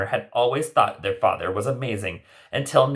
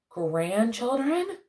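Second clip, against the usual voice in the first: surprised Cinderella; 13 semitones higher, 3 dB quieter, much slower than usual. Acted.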